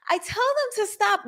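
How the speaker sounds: high mocking echo of her words; mock high teacher voice. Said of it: "No, you said".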